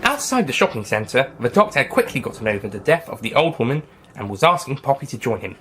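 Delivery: plain reading voice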